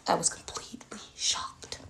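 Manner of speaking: whispered, stunned repeat; hushed emphasis. Real, not acted. Mother stressing her shock at the Lego church, hushed whisper.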